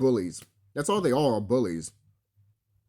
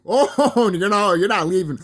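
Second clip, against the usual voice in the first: laughing taunt; 4 semitones higher, 6 dB louder than usual. Retold or imitated. Taunting enemies trying to leave.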